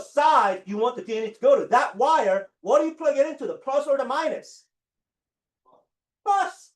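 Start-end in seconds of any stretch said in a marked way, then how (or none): 6.2-6.7 high, punched answer; emphatic answer. Real, not acted. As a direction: hit the answer hard. Teacher drills the class.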